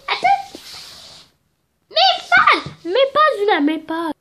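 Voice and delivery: high, loud alarmed call; calling out to Luna to leave the yarn alone; shout at Luna. Real, not acted. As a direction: stop her dog grabbing the yarn. Yells at Luna over her yarn.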